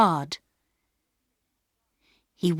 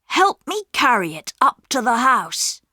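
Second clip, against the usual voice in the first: put-on higher, eager boy's voice; a keen young brother with a plan; boy Peter; 6 semitones higher, 4 dB louder than usual. A narrator's reading. Peter whispers a secret plan to his sisters, eager. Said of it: "said Peter".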